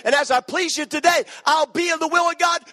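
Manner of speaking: high, loud climactic preaching; rising sermon fervor, loud and high; preaching at full force. Acted. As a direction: build the altar call to a shout.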